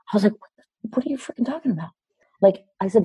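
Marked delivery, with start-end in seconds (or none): 0.4-1.9 high, fast, softer sputter; acted-out bewildered protest; baffled disbelief. Retelling her disbelief at his claim.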